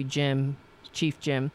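normal talking voice

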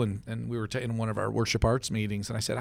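normal talking voice

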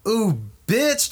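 high, sassy put-on exclamation; Wesley Snipes. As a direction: camp diva calling out a friend. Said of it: "talking to Kevin Costner".

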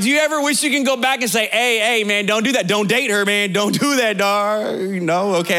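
ordinary talk

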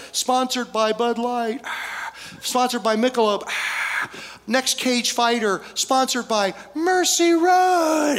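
slow, high mock announcer intro building to the last name; hammed-up fight announcer, weary then big on Mercy Road; mock announcer with sighs between sponsors; ring announcer. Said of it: "all the others are like".

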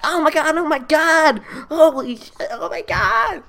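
high, loud imitation of a panicking teacher; mock-hysterical shrieking at a cockroach; freaking out. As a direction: act out the teacher's screaming panic. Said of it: "she starts freaking out".

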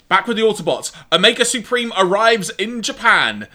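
usual speaking voice

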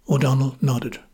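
plain reading voice

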